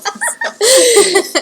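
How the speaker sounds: loud high bursting laugh; burst of laughter. Real, not acted. Laughing at the idea of dad planning a coffee shop, loud high laugh. Laughing about dad's view.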